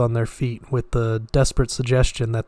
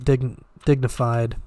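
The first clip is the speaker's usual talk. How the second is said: ordinary delivery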